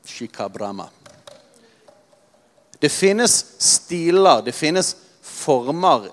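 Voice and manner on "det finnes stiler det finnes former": very slow, louder, weighing each word; slow down to drive it home; stressing the point to the congregation; slow emphasis. Real, not acted. Deliberate emphasis.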